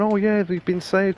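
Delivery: high, slow, hammed-up cry of relief; mock relief. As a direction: play the rescued crowd overjoyed, high and slow. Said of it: "the people going".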